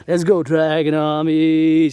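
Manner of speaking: loud, slow rallying cry; drawn-out battle cry; rallying call. Acted. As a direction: call the troops to march. Rallying call to his army.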